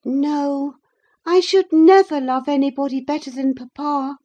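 put-on high, earnest girl's voice; grave. A narrator's reading. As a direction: answer firmly and seriously as a girl. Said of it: "she returned gravely".

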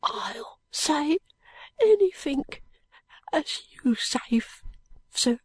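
put-on high, breathless, dying boy whisper; fading boy, barely voiced. A narrator's reading.

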